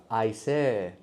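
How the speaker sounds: "I say." said the Swahili way, vowel of say drawn out; Swahili-accented "I say". Shown as a demonstration. Teacher showing the Swahili take on "I say". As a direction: Swahili speaker's "I say". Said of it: "Swahili adopted that marker".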